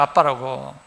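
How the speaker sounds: normal talking voice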